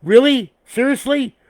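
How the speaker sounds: high, loud disbelieving quote; mock outrage at his own tinny mic; incredulous. Annoyed vlogger mimicking his reaction to the bad mic, incredulous. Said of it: "I'm like".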